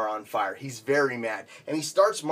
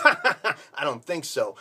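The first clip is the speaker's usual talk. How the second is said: slow, laughing dismissal; mocking laugh; much slower than usual. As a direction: laugh it off in his face. Laughing taunt after his boast.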